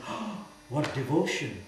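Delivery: slow mock-awed sigh of devotion. Master imitating people crying from tiger balm, mock devotion. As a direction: draw it out as an imitation of pious rapture. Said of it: "the tears will drop and say".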